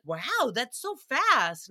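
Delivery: slow, drawn-out impressed wow; naive amazement. Retold or imitated. Dad gets smoothie instantly, imitated naive amazement. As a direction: stretch it out in wide-open wonder.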